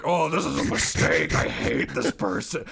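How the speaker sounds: hammed-up strained groan of regret; mock exasperation. Director pretending to regret casting in the booth, mock exasperation. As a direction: pretend to loathe the actor you cast.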